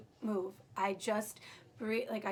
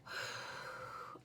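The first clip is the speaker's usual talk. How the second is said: slow, soft blown-out breath; demonstrated labour breath, steadily released; long breathing exhale; 11 dB quieter than usual. Shown as a demonstration. Patient breathing through the epidural, long controlled exhale. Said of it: "Deep breath through my nose, out through my mouth".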